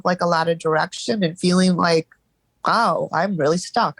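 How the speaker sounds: ordinary talk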